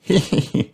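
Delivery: short high-pitched giggle. A narrator's reading. Peppa amused by George, giggling. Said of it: "laughs Peppa".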